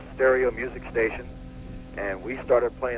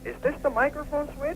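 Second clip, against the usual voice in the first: clueless newbie voice. Retold or imitated. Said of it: "Right, saying".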